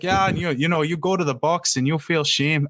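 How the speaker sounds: imitated Canadian goalie accent. Goalie describing the penalty box to an interviewer, imitated Canadian accent. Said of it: "just the Canadian accent".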